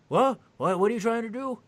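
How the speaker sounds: put-on high, loud bewildered old guard; confused old man. A confused old security guard, high and bewildered. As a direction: play a baffled old man.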